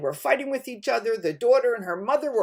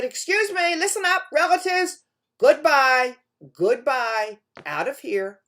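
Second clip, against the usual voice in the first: higher, louder shout to clear the house; calling out; 4 semitones higher, 8 dB louder than usual. Acted. Man ordering his relatives out of the house, loud and high. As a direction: shoo the relatives out loudly.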